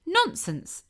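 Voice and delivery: sharp, high scoffing "Nonsense"; a high indignant snap; field mouse retort. A narrator's reading. Field mouse scolding Tiny, high indignant snap. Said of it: "replied the field mouse".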